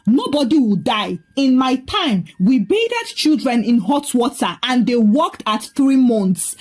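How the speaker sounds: usual speaking voice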